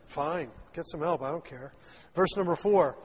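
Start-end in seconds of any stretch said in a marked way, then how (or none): 0.1-1.7 dismissive and lower, tossed off softly; arrogant dismissal. Retold or imitated. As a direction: brush them off like you couldn't care less. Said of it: "Nahash is so arrogant".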